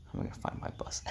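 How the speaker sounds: hushed, worried whisper to yourself; panicked whisper. Retold or imitated. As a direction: mutter it under your breath, overwhelmed. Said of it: "And I'm like".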